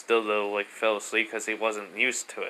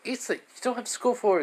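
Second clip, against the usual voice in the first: much higher, quick motherly voice; mom voice; 12 semitones higher, faster than usual. Acted. Mom fussing at son eating too fast, high and quick.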